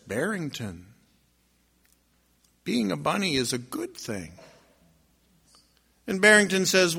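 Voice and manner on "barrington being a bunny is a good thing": low, soft wolf voice; hushed, solemn wisdom from the wolf; great wolf. Acted. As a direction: speak low and measured, with weight. Great wolf speaks to bunny.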